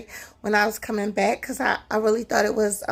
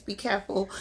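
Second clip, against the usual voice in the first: usual speaking voice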